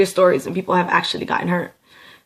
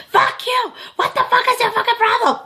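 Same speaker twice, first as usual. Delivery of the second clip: furious screaming, much higher; yelling. Retold or imitated. Yelling at her little sister who tells her to relax. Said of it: "This is me yelling the whole time".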